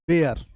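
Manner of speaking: बेयर with an "ay" vowel, rhyming with "air"; pronunciation demo. Shown as a demonstration. Teacher showing how the verb sounds, बेयर with "ay". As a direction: say बेयर as "bair", falling. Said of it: "जब इसका उच्चारण आप करेंगे".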